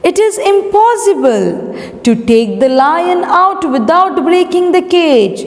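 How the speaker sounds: very high, emphatic protest; protesting courtiers. Acted. Courtiers telling king it can't be done, high voice.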